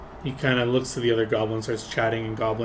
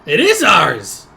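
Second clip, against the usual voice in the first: slow, louder insistence; stubborn claim; 7 semitones higher, 11 dB louder, much slower than usual. Acted. Goblin insisting to the party the place is theirs, slow louder. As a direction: plant your feet and claim it.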